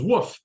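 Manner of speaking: short, high imitated bark; dog's woof. A narrator's reading. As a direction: woof like a story-time dog. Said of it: "Dog goes".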